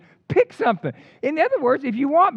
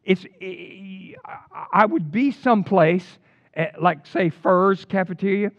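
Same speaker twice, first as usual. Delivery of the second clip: normal talking voice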